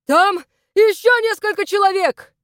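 high, loud warning cry; shouted alarm. A narrator's reading. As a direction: yell the danger to a comrade. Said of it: "крикнул он Доминику".

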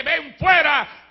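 slow, loud shouted "Ven fuera"; shouted command. Retold or imitated. Preacher imagining Christ calling all the dead. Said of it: "llega a decir solamente".

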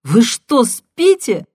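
high, loud disbelieving shout. Acted. Caller shouts at the stunned husband. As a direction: yell it at him in disbelief. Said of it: "крикнула она".

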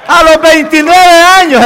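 high, loud, drawn-out proclamation; emphatic shout. Real, not acted. Preacher repeats it to an unconvinced congregation, emphatic shout. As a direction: shout it slowly at the crowd.

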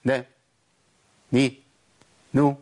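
نا، ني، نو each snatched very short; too quick, snatched. Shown as a demonstration. Teacher explaining stolen vowels, over-short syllables. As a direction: clip each of نا، ني، نو below a full beat. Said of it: "اسرع من كده".

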